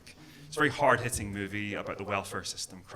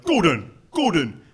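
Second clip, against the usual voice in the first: louder, higher mock English voice; English people remarking; 7 semitones higher, 10 dB louder than usual. English people telling Gordon he doesn't sound Glaswegian, mock puzzled. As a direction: imitate a puzzled English person. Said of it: "English people are always like".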